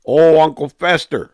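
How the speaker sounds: loud, high excited exclamation. Real, not acted. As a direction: burst out with delight. Repeats the nickname, excitedly.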